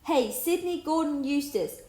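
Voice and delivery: yelling at the lazy bear; yelled. A narrator's reading. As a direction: bellow the full name slowly. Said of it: "yelled Tom".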